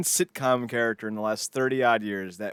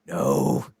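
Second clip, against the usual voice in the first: loud, rising mock panic; hammed-up meltdown, pitch climbing; freaking out; 7 semitones higher, 6 dB louder, much slower than usual. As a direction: freak out louder with each phrase. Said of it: "he just starts freaking out".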